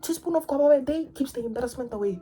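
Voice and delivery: high, fast parody of the slogan; mock TikTok slogan. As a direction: rattle off the catchphrase in a squeaky mocking voice.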